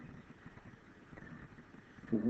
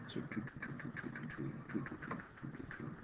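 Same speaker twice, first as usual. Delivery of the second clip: quiet murmured counting; muttering under breath; 5 semitones lower, 7 dB quieter than usual. Real, not acted. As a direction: mumble the numbers softly to yourself.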